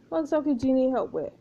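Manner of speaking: ordinary talk, nothing marked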